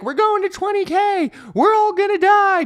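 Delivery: high, mock-panicked wail; panicking crowd. Mocking panicked traders fearing a crash, high wail. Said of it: "Everyone's like".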